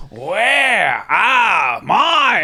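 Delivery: very slow, high, drawn-out mock bellow; angry demand. Wayno demanding his missing sandwiches, slow high mock yell. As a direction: stretch every word like an enraged foreman. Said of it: "he said it again".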